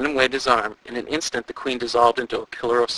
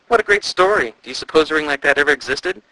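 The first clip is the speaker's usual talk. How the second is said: excited; 4 semitones higher, 6 dB louder than usual. A narrator's reading. Andrew excited after reading.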